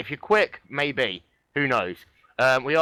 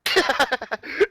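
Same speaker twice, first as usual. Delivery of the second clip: high laugh; 12 semitones higher, 3 dB louder than usual. Real, not acted. Laughs after own impression.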